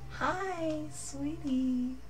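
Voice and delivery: high and hushed; soft high coo. Retold or imitated. Soft high greeting to Baldi.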